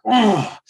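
loud, high exasperated ugh; groaning about the client who just cancelled; frustrated groan. Retold or imitated. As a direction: let out a fed-up groan.

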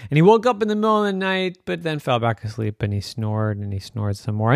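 ordinary delivery

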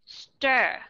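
"Stir" with a full, clear er vowel; clear ER syllable. Shown as a demonstration. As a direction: sound out "Stir" as a strong first syllable. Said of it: "âm thứ nhất".

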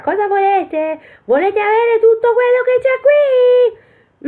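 very high, slow, loud squeal; shrieking Pollicino. Acted. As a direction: belt it out in a tiny operatic squeak. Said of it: "e gridò ancora". (urlo acutissimo con vocina)

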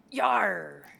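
slow, loud pirate growl; high, drawn-out pirate "yar". Pretend. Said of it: "I'm gonna do my shittiest pirate voice".

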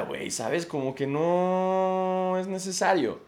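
ordinary delivery

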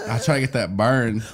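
usual speaking voice

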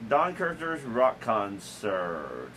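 slow and drawn out, like a TV announcer; slow announcer read. Pretend. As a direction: stretch every word like an old TV intro.

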